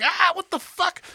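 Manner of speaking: high-pitched mock yelp of pain; retold pained scream. She touches the hot wall, high mock scream. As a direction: imitate her high cry of pain. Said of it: "she screams out in pain".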